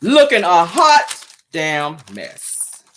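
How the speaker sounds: slow, loud, stretched hot damn mess; drawn-out judgment. Shown as a demonstration. Host roasting an outfit, slow and loud.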